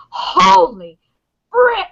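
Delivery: high, loud, drawn-out amazement; re-enacted astonishment. Fan relives three massive episodes in a row, acted astonishment.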